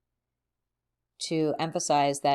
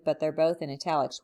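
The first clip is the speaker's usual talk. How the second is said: normal talking voice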